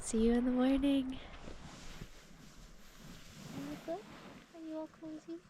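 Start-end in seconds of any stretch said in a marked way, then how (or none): 3.5-5.4 sleepy talk to pet. Real, not acted. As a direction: coo gently to a sleepy pup. Tucking in the dog.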